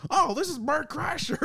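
put-on high, goofy Southern accent. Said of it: "a stupid Southern accent on".

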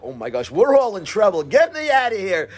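acting out a tearful panic; half-earnest, pleading wail of distress; mock panicked crying. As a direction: play up the alarm of someone in trouble. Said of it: "He's, like, crying, like".

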